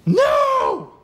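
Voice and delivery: piercing high, drawn-out scream; loud shriek that leaps far above normal pitch. Acted. A scream heard from the lifeguard chair, high scream. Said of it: "I hear a scream".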